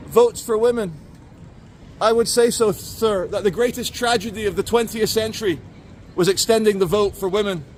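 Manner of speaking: mock sexist ranter. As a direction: lampoon a smug anti-suffrage crank. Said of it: "And, uh, she says".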